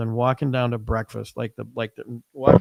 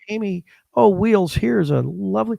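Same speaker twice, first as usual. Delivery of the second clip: high-pitched, gushing mock generosity; gushing gift giver; 6 semitones higher than usual. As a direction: present it like a wonderful favor. Acting an effusive gift giver.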